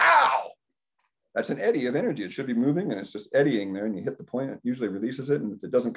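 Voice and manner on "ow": much higher, louder sharp ow; sudden mock cry of pain; pain yelp. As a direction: yelp as the needle hits the point. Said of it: "you go".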